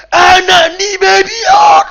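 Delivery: high, very loud, drawn-out scolding; acted maternal outburst, bellowed; furious mother's yell. Mother yelling insult at child.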